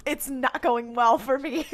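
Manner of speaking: slow, drawn-out line breaking into laughter; laughing mock confession. Co-host jokes as struggling Sam, slow and laughing.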